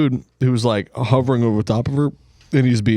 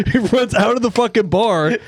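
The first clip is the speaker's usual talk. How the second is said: normal talking voice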